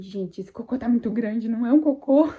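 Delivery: loud, rising alarmed push; startled while bearing down; straining exclamation. Retold or imitated. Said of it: "Eu falei assim".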